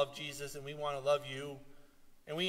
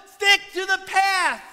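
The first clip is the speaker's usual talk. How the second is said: pastor's loud warning to stray Christians; loud command; 12 dB louder, slower than usual. Acted. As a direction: Stick to the path called out high and loud. Loud command, then repeated softly.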